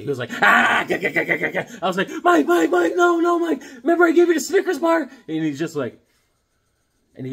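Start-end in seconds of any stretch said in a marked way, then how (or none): 0.4-1.8 loud yelled machine-gun stutter; mimicked snapping outburst. Coworker snapping at the office, loud imitated rampage noise. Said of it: "he lost it one day". 2.2-5.0 very high, loud frantic shout; panicked pleading. Acted. Begging a snapped coworker to remember the candy, panicked high shouting.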